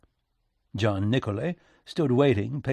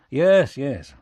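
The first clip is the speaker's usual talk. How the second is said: loud, higher, impatient cut-in; interrupting; 4 semitones higher, 9 dB louder than usual. Acted. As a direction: cut in briskly over the other speaker. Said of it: "Lincoln interrupted".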